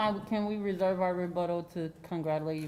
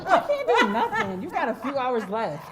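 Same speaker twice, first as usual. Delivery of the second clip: laughing; 5 semitones higher, 9 dB louder than usual. Real, not acted. Joking with departing board member.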